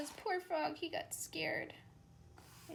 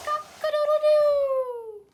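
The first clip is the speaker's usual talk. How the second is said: high, loud, slow cock-a-doodle-doo; rooster crow; 14 dB louder, slower than usual. Acted. Teacher showing a child the rooster's crow, loud and high. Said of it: "what he says is".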